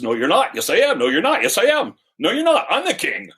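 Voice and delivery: squabble, each retort topping the last; bickering back and forth. Acted. King and beggar man arguing back and forth, hammed-up bickering.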